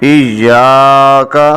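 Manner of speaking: ایاکا drawn out slowly; correct recitation. Shown as a demonstration. Teacher gives the right reading, slow chant. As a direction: stretch the final ka. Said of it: "ایاکا غلط ہے".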